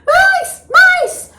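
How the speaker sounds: high, loud alarmed shout; mice! shrieked high and loud; panicked cry of mice. Retold or imitated. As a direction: yell it out in a panic. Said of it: "I'm like".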